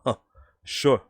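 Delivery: skeptical New York aside; New York accent. Acted. Journalist scoffing at online bookselling, New York accent. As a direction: toss it off like a doubting New Yorker. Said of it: "I'm gonna do a New York accent".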